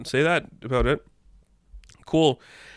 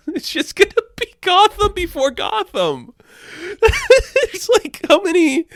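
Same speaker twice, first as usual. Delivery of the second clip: high-pitched, talking through real laughter; helpless squeaky laughing speech; laughing hard; 3 dB louder than usual. Real, not acted. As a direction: crack up while trying to get it out. Cracking up at own Gotham joke.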